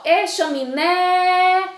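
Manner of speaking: loud, high "chaminé" with the final "né" held long; slow sing-song hail on "chaminé", last syllable drawn out; calling the word. Shown as a demonstration. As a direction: call "chaminé" as if from afar, stretching the end. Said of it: "olha só".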